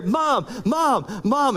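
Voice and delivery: mock back-seat nagging; pestering kid. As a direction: keep badgering mom like a bored kid. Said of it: "he's, like, kicking her chair".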